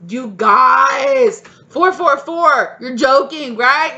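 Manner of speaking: high, loud disbelieving cry; real astonished yelling; shocked shout. Real, not acted. Shocked by the numbers.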